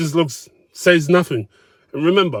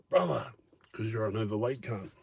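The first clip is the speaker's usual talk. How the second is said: much softer, under-the-breath jab; softer private dig at the diner; muttered aside; 3 semitones lower, 13 dB quieter than usual. Retold or imitated. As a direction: mutter the insult to yourself. Inner retort, under breath.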